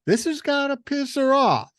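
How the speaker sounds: high, loud exclaim. Retold or imitated. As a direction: burst out with it. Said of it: "I'm thinking".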